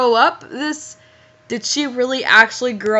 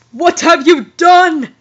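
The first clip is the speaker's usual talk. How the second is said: mock angry; 7 semitones higher, 7 dB louder, slower than usual. Dumbledore's line read aloud.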